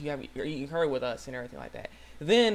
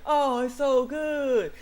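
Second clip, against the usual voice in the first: high, loud, drawn-out gushing praise; mock rapture; 10 semitones higher, 5 dB louder, much slower than usual. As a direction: gush slowly in hammed-up delight. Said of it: "he's like".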